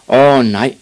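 alarmed cry. Acted. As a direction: call out in dismay. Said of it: "råbte lystfiskeren".

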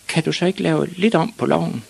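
put-on high-pitched child's request; high, hopeful boy voice; questioning small boy. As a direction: ask naively, like a little pupil. Ivan asks teacher.

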